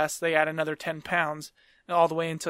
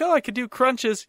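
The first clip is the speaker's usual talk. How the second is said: mock excuse; 7 semitones higher, 5 dB louder than usual. Retelling his excuse at physical.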